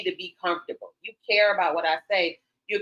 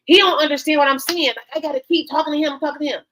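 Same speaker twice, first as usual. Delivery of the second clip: higher, louder frustrated whine; complaining woman; 6 semitones higher, 5 dB louder than usual. Retold or imitated. Women complaining men don't listen, mock exasperated whine. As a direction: mimic a fed-up woman venting. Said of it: "I hear a lot of women like".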